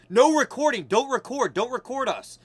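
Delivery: high insistent orders, loud then fading; imitated protesters. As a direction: mimic people repeatedly forbidding something. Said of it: "they're like".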